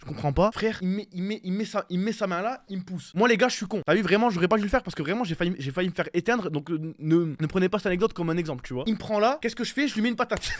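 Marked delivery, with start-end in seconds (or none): none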